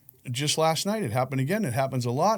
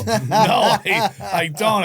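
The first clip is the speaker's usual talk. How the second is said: laughing; 6 semitones higher, 8 dB louder, much slower than usual. Real, not acted. Laughing at not knowing slang.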